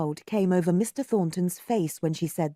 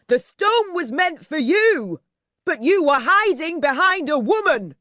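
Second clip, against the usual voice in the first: crying out; 8 semitones higher, 7 dB louder than usual. A narrator's reading. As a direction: heckle from the crowd. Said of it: "Only one voice cried".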